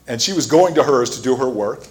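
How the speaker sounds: ordinary talk, nothing marked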